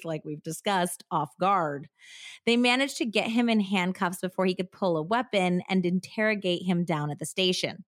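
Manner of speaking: usual speaking voice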